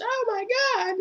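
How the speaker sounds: very high mock shriek; shocked shriek. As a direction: squeal it in sudden shock. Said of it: "and then she's".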